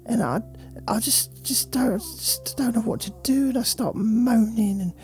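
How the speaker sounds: sulky, bored dog complaining about its owner; a neglected pet moaning, half whisper at times. Acted. Voicing the dog's thoughts.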